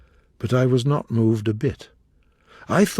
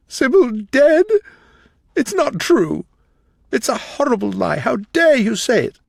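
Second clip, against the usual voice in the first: much higher, louder anguished protest; cry of pain; 11 semitones higher, 6 dB louder than usual. Acted. Dorian hears Sybil is dead, crying out in anguish. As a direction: refuse the news with a stricken cry. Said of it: "A cry of pain broke from the lad's lips".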